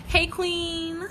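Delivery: bright, high, friendly "Hey, queen"; perky sisterly hello; friendly greeting. Retold or imitated. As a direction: greet her warmly like a friend. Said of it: "Let me just approach this person with".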